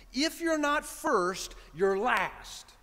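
a slow, weighty first take of the motto; drawn-out quote. Retold or imitated. Retelling the father's motto slowly to the congregation.

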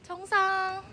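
proud arrival cry; a glad shout of reaching the top; triumphant. Real, not acted. Arriving at the summit.

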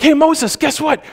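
high, panicky mock plea of the frightened people; lightly hammed-up terrified Israelites begging Moses; scared crowd pleading. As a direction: hand it off to Moses like a nervous crowd. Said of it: "And they were like".

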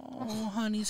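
usual speaking voice

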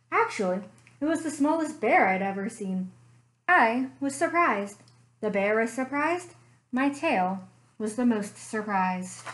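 story narration. A narrator's reading. Librarian reading a bear story to children, gentle storytelling. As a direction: draw the kids into a cozy mystery tale.

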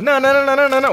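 high, loud string of nos; rapid frantic denial; panicked no. Real, not acted. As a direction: plead in alarm. Panicking as round is lost.

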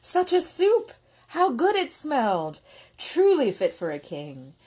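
high, loud gush of delight. A narrator's reading. Narrator sharing villagers' joy at the soup, delighted. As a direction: savor the smell.

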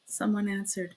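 ordinary delivery

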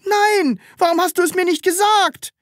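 very high, loud protest; exasperated outburst; agitated reproach. Acted. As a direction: cry out indignantly, pitch shooting up. Upset at late revelation.

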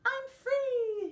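high, loud, gleeful yelp; yelping with joy. A narrator's reading. Mocha the dog reaching the mountaintop, yelping with joy. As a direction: yelp it out like a dog let loose. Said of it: "he yelped".

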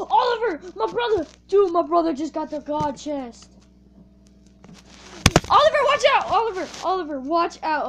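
loud high calls to watch out; urgent warning shout. Real, not acted. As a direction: warn him urgently.

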